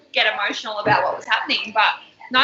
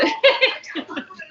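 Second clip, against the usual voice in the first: laugh; 9 semitones higher than usual. Real, not acted. Laughing about Bill's edit.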